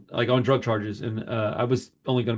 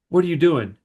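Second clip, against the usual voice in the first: guard; 5 semitones higher, 5 dB louder than usual. Retold or imitated. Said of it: "And he's like".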